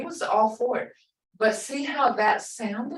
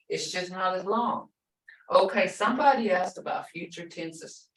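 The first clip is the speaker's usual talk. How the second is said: normal talking voice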